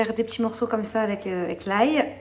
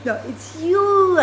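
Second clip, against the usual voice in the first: high sweet mom voice; 11 semitones higher, 10 dB louder, much slower than usual. Real, not acted. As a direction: coo over the gift like a proud mom. Mother cooing to child.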